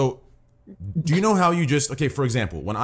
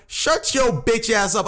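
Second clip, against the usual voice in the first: drawn-out, higher mock telling-off; imitating a sassy woman; 5 semitones higher, slower than usual. Speaker quoting a woman from Gordo, slow mock put-down. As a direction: stretch it out slowly, imitating her telling someone off. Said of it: "she's like".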